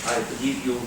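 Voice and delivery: ordinary talk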